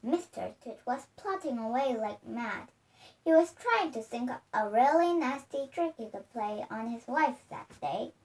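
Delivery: ordinary delivery, nothing marked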